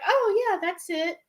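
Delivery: very high "oh, yeah"; imitated dawning recognition. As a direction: play staff suddenly recognizing it, high-pitched. Acts staff's sudden recognition.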